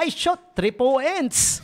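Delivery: lightly mimicking mom's Bisaya English; mom's accent. Son imitating his funny mom at a PBA game, slow and high. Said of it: "Sabi niya".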